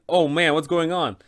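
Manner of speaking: ordinary talk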